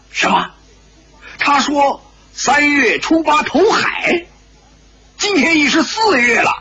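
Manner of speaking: high-pitched, incredulous shock; taken aback, voice jumping high; stunned. A narrator's reading. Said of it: "丁不四一呆". (惊呆了，高声追问)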